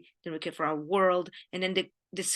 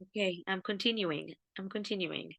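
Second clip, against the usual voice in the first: softer, tearful, steadying herself; composing herself; 7 dB quieter than usual. Real, not acted. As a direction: gather yourself quietly after tears. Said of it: "I'm trying not to cry".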